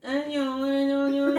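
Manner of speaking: high stammering little voice; a little boy at a loss; tongue-tied kid. Retold or imitated. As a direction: stumble over the words like a shy child. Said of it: "Then she was like".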